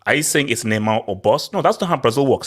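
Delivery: ordinary talk, nothing marked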